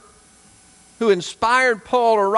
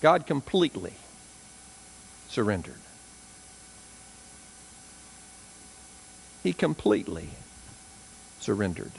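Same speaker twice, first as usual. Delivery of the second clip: hushed solemn; 9 semitones lower, 10 dB quieter than usual. Real, not acted. Preacher sobering the church after the climax, soft and low. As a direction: drop to a much softer, lower voice.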